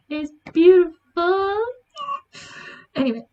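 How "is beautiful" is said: higher, louder and slower, swooning; real swoon; gushing. Real, not acted. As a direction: melt over how lovely it is. Gushing over the ceremony.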